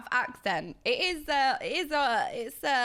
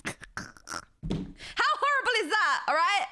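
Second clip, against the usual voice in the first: appalled outburst; 8 semitones higher than usual. Real, not acted. Streamer recoils from their own accent demo, appalled.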